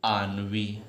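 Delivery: आनवी as aan-vee, soft nasal start; pronunciation demo. Shown as a demonstration. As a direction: model आनवी gently for students. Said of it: "pronunciation करने में गलती करोगे". (आनवी का सही उच्चारण)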